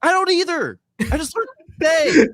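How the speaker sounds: high-pitched, loud outburst; loud protest. Real, not acted. Co-host admitting he only just learned, loud high-pitched protest. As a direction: yell it back in a high voice. Comic protest at not knowing.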